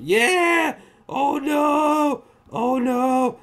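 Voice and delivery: high, loud exclamations; dismayed outburst. Real, not acted. Reviewer reacting to a dark movie moment, loud dismay.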